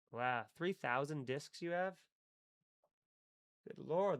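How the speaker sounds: normal talking voice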